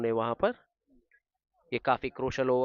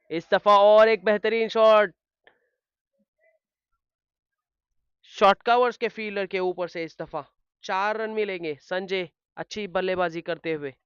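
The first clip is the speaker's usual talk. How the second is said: rising, louder call of a boundary shot; excited cricket commentary; 4 semitones higher, 7 dB louder than usual. Acted. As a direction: lift the voice for the shot. Live play-by-play of a boundary.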